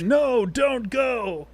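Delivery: high, slow, dragged-out cry; desperate plea. Retold or imitated. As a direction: plead as a friend is taken. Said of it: "That's his next".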